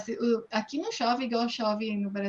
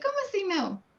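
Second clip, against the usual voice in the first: surprised; 10 semitones higher than usual. Retold or imitated. Retold surprised reply on bus.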